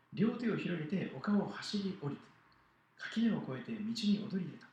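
put-on animated children's story narration; lively Bible story read to children; storytelling to children. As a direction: tell it to the kids with big expressive swings.

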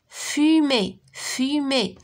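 "Fumée" split into fu and mée, each drawn out; syllable by syllable. Shown as a demonstration. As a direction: say "Fumée" in two long, separate syllables. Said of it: "Frappe les syllabes".

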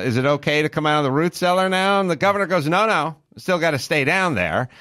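ordinary delivery

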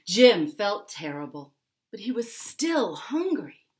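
ordinary delivery, nothing marked

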